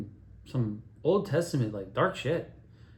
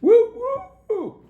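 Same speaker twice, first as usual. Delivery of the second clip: high loud party whoops; excited whooping; 12 dB louder, much slower than usual. Retold or imitated. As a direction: whoop like a wild crowd. Cheering at the wedding.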